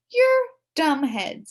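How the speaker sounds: high, slow, loud retort; girl snapping back at the boys; huffy insult. Acted. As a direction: spit it at the boys.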